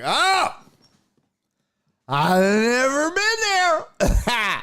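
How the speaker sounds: put-on high, slow, slurred drunk-guy drawl. Narrator mimics the drunk guest meeting the couple, high slurry drawl. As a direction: play the sloppy drunk fumbling a reply.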